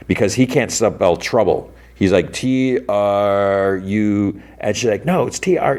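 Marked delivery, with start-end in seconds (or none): 2.3-4.4 very slow, drawn-out letters; laboured spelling. Retold or imitated. Boy struggling to spell trouble. 5.0-5.8 high-pitched mock yell; retold yelling. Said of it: "she's, like, yelling".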